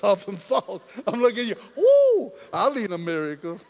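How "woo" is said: a loud, high whoop; joyful whoop. Real, not acted. Preacher whooping with joy at the congregation.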